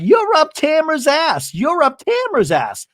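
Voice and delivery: high-pitched mock jab, repeated; retold taunting accusation. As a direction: imitate a bickering friend. Acted quarrel accusation.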